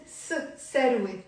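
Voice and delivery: ثروة begun with S instead of TH; Lebanese pronunciation. Shown as a demonstration. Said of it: "but in the Lebanese accent".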